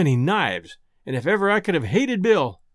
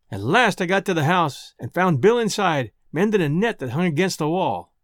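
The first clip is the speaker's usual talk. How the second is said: ordinary delivery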